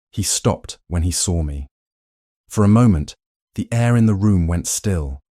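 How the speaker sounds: ordinary delivery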